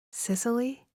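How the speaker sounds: Sicily? asked in disbelief, pitch climbing up; incredulous. Acted. Katya questions the narrator, Sicily? rising in disbelief.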